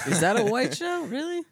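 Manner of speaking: squeaky high disbelieving question; high-pitched shocked "Really?"; incredulous. Real, not acted. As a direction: shoot up in pitch in mock disbelief.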